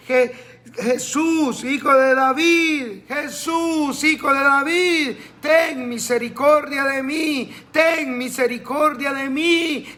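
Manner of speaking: mock pleading cry. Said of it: "empezó a gritarle".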